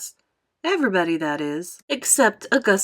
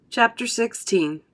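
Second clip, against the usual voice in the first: ordinary delivery, nothing marked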